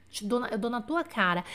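normal talking voice

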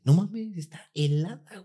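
high, then slow and soft; shocked discovery. Retold or imitated. Touching the dead bird and finding it cold, high then hushed. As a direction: gasp out the shock slowly.

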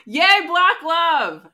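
slow, drawn-out fake cheer; mock cheer. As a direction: cheer it with irony.

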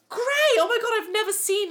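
high, loud, thrilled exclamation; imitating his high-pitched delight; excited imitated client. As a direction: gush like an overexcited kid. Client's excited reaction retold.

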